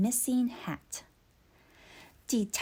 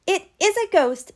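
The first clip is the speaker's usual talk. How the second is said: scared; 8 semitones higher, 7 dB louder than usual. Acted. Scared boy in the dark.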